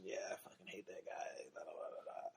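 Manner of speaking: hushed mock whisper of a grudge; whispered aside. Reminiscing with an old friend, whispered grudge. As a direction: drop to a whisper as if sharing gossip. Reminiscing about a disliked person.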